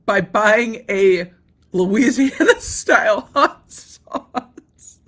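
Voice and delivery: drawn out very slowly, breaking into laughter; laughing. Real, not acted. Mocking the buyer, slowly laughing through hot sauce. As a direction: stretch it out and crack up.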